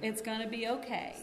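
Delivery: put-on softly soothing a crying toddler; calm soothing mom. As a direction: hush it gently, like a patient mother.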